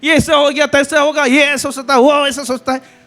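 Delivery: high, insistent exhortation; forceful preaching. Real, not acted. Preaches with raised voice.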